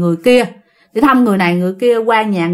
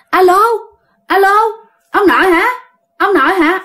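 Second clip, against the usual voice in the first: acted phone call; 8 semitones higher than usual. Uncle phones grandfather and checks it is him, raised calling voice. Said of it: "tự nhiên để cái điện thoại lên".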